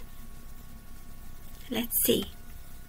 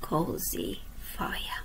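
slow whispered ending, drawn out; hushed refrain; 5 semitones lower, 6 dB quieter, much slower than usual. A narrator's reading. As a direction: breathe the last words like a lullaby. Refrain end of each verse.

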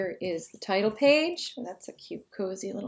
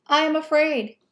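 higher-pitched, mildly frightened little mouse; frightened cry; 7 semitones higher, 4 dB louder than usual. A narrator's reading. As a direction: voice a touch of childlike fear. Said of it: "cried the little mouse".

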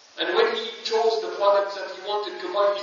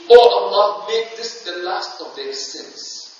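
fervent prayer; 2 semitones higher, 11 dB louder than usual. Retold or imitated. Abdullah ibn Umar prays aloud.

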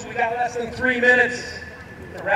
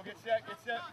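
usual speaking voice

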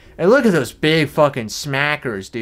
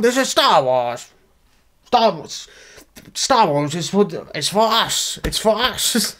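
lightly voiced whiny gatekeeper fan, a bit higher; mock a petty fan with a higher pitch; 5 semitones higher than usual. Mock bigot rant persona.

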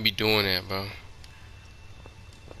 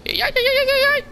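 very high, rapid yelps; panicked shrieking; 4 dB louder, faster than usual. Real, not acted. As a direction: yelp it out in a frenzy. Frantic reaction in the game.

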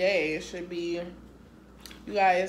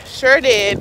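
louder, proud repeat; 4 semitones higher, 17 dB louder than usual. Real, not acted. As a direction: say it again, prouder. Proudly using gift card.

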